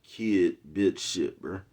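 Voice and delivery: normal talking voice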